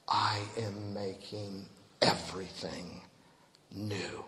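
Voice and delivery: low, soft voice of God from the throne; hushed divine declaration. A narrator's reading. Preacher quoting the One on the throne, hushed and low. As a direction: drop low and quiet, solemnly.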